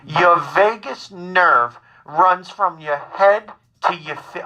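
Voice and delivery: slow, measured mother impression; calmly insistent mother. Son imitates his worried mother, calmly insistent. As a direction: stretch each word out like a patient, insistent mother. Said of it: "my mother is very calmly telling me".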